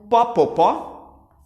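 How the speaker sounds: put-on a light mineiro accent on "pó", loud; a playful imitation of a mineiro saying "pó"; Minas accent. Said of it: "mineiros podem pedir café". (sotaque mineiro leve)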